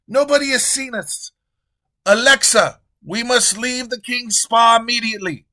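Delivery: high, loud frantic king; high, loud king urging Alexa to flee; panicked king. Acted. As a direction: hurry everyone out nervously. King panics after killing.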